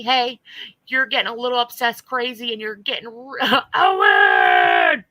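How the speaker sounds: mock warning yell. Subs warning her, playful yell.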